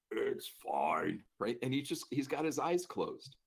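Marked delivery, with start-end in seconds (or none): 0.1-1.2 low, gravelly reassurance; gruff patient's low rumble; deep growly voice. Retold or imitated. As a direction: rumble it deep, brushing off concern. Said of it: "deep growly voice".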